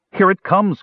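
high-pitched cry of warning; alarmed cry. A narrator's reading. Parian warns as tentacles appear, alarmed cry. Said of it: "cried Parian".

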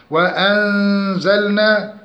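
وأنزلنا with a nasal hum before z; recited وأنزلنا with ghunna; hidden nun. Shown as a demonstration. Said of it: "إخفاء حقيقي".

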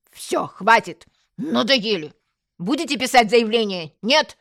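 loud, impatient policeman; exasperated, forceful questioning; fed up. Acted. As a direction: cut the quarrel off and demand an answer. Fed-up policeman stops the quarrel.

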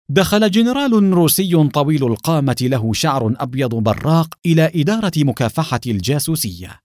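ordinary delivery, nothing marked

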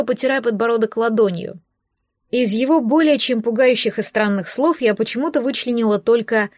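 usual speaking voice

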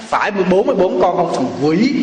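menacing retold demand through gritted teeth. Angry buyer confronts the lying kid, through gritted teeth. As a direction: press the accusing question, teeth clenched. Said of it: "ông nghiến răng lên, ông làm cái ghê".

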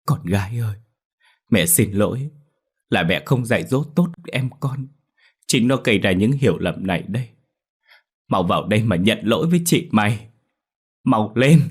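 lightly tearful apology turning urgent. A narrator's reading. Mother holds her dead daughter, mildly tearful then urgent. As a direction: apologise through tears, then hurry her. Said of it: "nước mắt giàn giụa".

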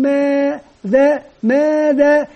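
stretched teacher prompt; slow prompting question. Shown as a demonstration. Teacher coaxing pupils to answer, slowly. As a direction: drag out ماذا to invite an answer.

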